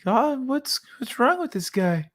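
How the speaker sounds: high, puzzled animal voice; llama voice. Retold or imitated. Voicing confused llamas.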